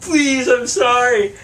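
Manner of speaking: high, slow pleading; begging drunk. Retold or imitated. Drunk friend on his knees begging the stranger, high and slow. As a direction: beg on your knees, drawn out. Ryan kneeling, praying to guy.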